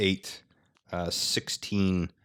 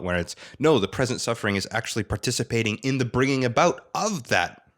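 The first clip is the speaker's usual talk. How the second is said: usual speaking voice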